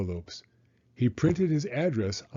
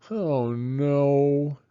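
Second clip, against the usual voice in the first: put-on higher, stretched child's wail; 4 semitones higher, 4 dB louder than usual. A narrator's reading. Franklin stuck on a bare patch, wailing. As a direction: let the disappointment ring out. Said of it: "wailed Franklin".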